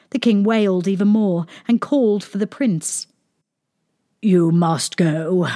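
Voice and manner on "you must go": slow, heavy-hearted dismissal; sad. A narrator's reading. King sending away his son, sadly. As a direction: say it slowly with understated sorrow. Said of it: "he said sadly".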